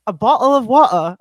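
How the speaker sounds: mock British pronunciation of "water"; English accent. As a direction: put on an English accent, emphatic. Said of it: "then she actually developed this really cute American accent".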